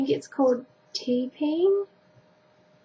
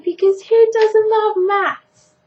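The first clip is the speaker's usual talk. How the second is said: sarcastic; 10 semitones higher, 8 dB louder, slower than usual. Real, not acted. Sarcastic rhetorical question.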